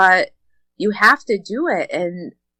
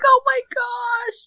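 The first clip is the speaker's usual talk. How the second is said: much higher, slow drawn-out exclamation; overwhelmed surprise; slower than usual. Retold or imitated. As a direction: gasp it out, stretched and high. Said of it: "I was like".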